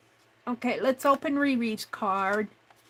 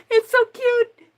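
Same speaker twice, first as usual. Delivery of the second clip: delighted; 11 semitones higher, 9 dB louder than usual. Real, not acted. Card opened, delighted squeal.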